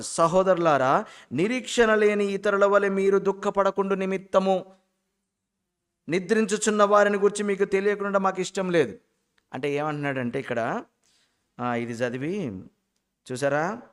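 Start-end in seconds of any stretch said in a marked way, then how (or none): 0.0-9.0 higher, sustained reading-aloud tone; scripture reading. Real, not acted. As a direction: read the verse out on a raised, level note. (లేఖనం చదివే స్వరం)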